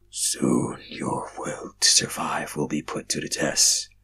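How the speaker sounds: low, slow, breathy whisper of a hidden being; deep whisper. Acted. Mysterious voice warns the dreamer, low whisper. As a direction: menace them from the dark in a low whisper. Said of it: "a deep voice said".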